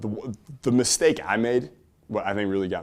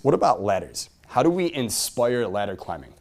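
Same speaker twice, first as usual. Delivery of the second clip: ordinary talk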